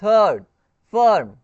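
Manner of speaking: थर्ड and फर्म said loud with a short "uh" vowel; careful teacher demo of थर्ड, फर्म; pronunciation demo. Shown as a demonstration. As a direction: model थर्ड, फर्म clearly, one by one. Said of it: "यहाँ पे अ प्रोनाउंसिएट हो रहा है ना".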